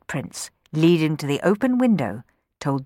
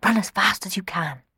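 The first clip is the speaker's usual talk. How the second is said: whispered urging; 3 semitones higher than usual. Acted. Rowan urging Sammy on from the window, whispering. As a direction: breathe it after him.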